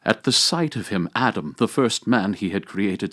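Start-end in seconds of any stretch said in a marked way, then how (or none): none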